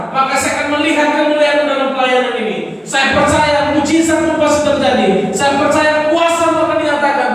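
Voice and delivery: loud, high fervent preaching; preaching at full force. Acted. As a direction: fire up the congregation.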